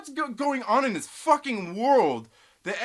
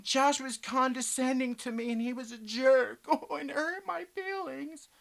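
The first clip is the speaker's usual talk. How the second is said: mock whiny complaint; 4 semitones higher, 5 dB quieter than usual. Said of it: "I'm not sitting here saying".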